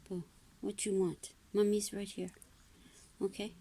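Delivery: soft, gentle mommy voice. Real, not acted. Mother comforting her fussing child.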